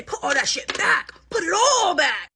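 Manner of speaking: slow, loud firm order; stern mom. Retold or imitated. Telling the mom what she should say, stern and firm. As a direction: lay down the law slowly. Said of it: "you're supposed to say".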